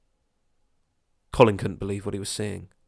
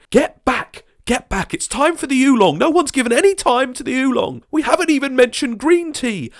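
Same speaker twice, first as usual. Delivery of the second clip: much higher, loud bossy voice; pushy shouting neighbour; 7 dB louder than usual. Acted. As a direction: shove the others aside for the oolong. Neighbour shoving in.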